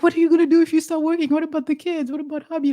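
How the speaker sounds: retold alarm. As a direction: rattle off the worried questions quickly. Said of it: "I'm like".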